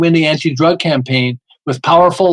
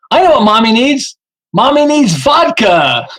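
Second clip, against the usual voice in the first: punchline delivery; 5 semitones higher, 4 dB louder than usual. Acted. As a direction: land the joke like a tired mom's confession. Punchline of mom's page name.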